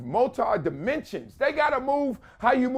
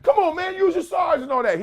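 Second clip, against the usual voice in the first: mock coach urging; 9 semitones higher than usual. Coaching the big kid.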